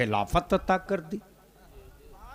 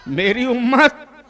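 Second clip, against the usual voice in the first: high, rising cry of appeal; raised plea; 7 semitones higher, 6 dB louder than usual. Acted. Preacher voices the Prophet pleading for his community, raised plea. Plea repeated after calling for volume.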